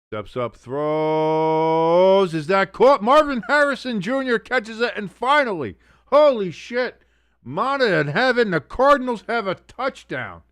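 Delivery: loud, high, stretched-out play call; excited touchdown call. Real, not acted. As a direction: erupt as the pass is caught.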